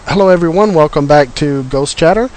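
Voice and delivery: ordinary talk, nothing marked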